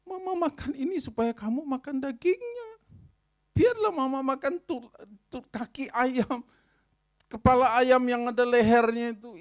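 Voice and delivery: high, loud, impassioned mother; a mother pouring out her sacrifice; mother's heartfelt plea. Acted. Preacher voicing a mother explaining to her children, high and impassioned.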